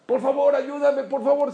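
ruego agudo y fuerte; gritos de auxilio. Retold or imitated. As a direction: imita a una madre pidiendo ayuda a gritos. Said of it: "empezó a gritar más". (súplica desesperada)

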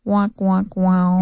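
"wah-wah" losing sound effect; mock failure horn. Teacher teasing a stumped student, mock failure horn. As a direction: imitate a sad trombone, drawn out and low.

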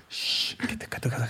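soft, voiceless shushing; hushing. Retold or imitated. Husband hushing woman in labour during penalties, mock hush. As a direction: imitate frantically shushing someone during a match. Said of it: "y yo".

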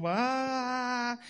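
soft hammed-up whimper; mock sob. Crying man in the joke whimpers softly. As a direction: fake a little cry. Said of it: "Estaba llorando uno".

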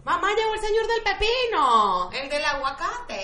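put-on high excited child's call to mom. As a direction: shout up to mom that the vendor came. Child calling mother about vendor.

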